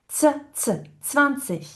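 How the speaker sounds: "Tz, tz" shown alone, then in "zwanzig"; isolated tz sound. Shown as a demonstration. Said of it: "on n'a pas le tz". (« Tz, tz » isolé, puis dans « zwanzig »)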